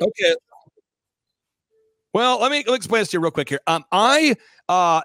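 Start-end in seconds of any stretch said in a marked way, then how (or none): 0.0-0.3 a sudden high, rising okay; surprised okay. Real, not acted. Asked to explain it all, he answers with a startled high okay. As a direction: caught off guard, shoots the pitch up on okay.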